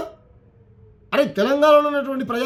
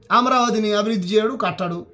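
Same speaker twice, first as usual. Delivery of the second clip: ordinary delivery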